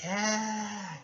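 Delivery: high-pitched mock yelp; retold cry of alarm. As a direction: imitate a startled cry while falling. Cry as she is pushed over edge.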